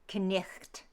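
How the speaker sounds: "knicht" as k-nikht, every letter sounded; phlegmy GH. Shown as a demonstration. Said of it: "get the phlegm going".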